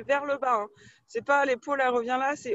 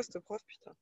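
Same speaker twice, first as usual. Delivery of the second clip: chuchoté; 7 semitones higher, 17 dB quieter than usual. Real, not acted. Joking aside about the teacher.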